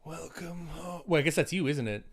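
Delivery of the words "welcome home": slow, eerie creature growl; monster voice. Acted. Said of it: "I like doing my monster voice".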